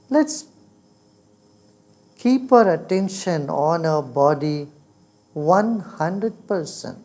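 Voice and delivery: guided meditation. Real, not acted.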